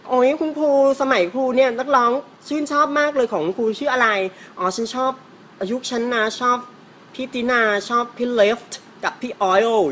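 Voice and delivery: high-pitched pretentious put-on voice; hammed-up, high, showy chatter; affected. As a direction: gush in an affected way, showing off English-style names. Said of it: "ดัดจริตมากเลย".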